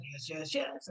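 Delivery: soft, low, agreeable yes-voice; a quiet inner yes, nodding along; supportive inner voice. Retold or imitated. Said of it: "so the voice that says".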